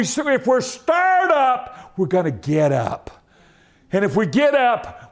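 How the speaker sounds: high, driving exhortation; fervent preacher's cadence; preaching. Real, not acted. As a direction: build a chain of punched pulpit phrases. Preaches at full force.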